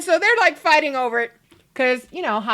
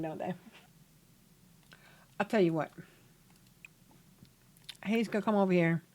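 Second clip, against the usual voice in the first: usual speaking voice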